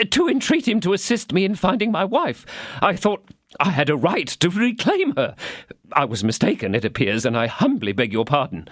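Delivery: high, anxious, apologetic tradesman; timid Bonacieux. Acted. Bonacieux excuses himself to the commissary, nervously. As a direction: grovel a little for pardon.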